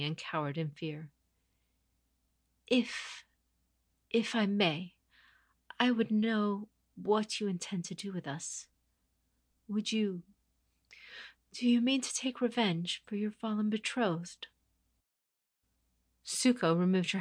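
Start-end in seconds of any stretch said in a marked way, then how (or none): 2.7-14.5 higher, hesitant lady's voice; a scared noble lady pleading; frightened noblewoman. Acted. Captive lady asking the conqueror her intent, frightened and higher.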